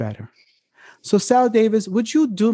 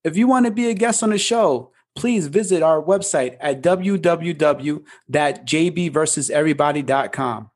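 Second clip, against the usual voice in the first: normal talking voice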